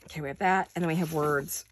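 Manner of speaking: ordinary talk, nothing marked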